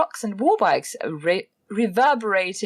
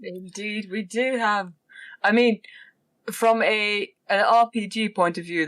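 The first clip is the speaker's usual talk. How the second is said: ordinary talk, nothing marked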